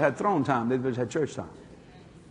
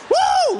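high loud whoop of joy; excited whoop; 6 dB louder than usual. Retold or imitated. Dad celebrating with the kids, loud whoop. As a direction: let out a big happy woo.

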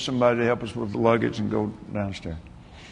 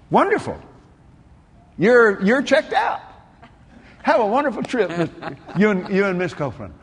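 cheerful clerk; 6 semitones higher, 4 dB louder than usual. Retold or imitated.

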